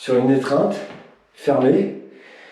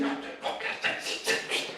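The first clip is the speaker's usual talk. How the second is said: near-whispered count under strain; doing push ups; 15 dB quieter than usual. Real, not acted. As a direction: gasp out the count mid push-up. Coach counting during push-ups.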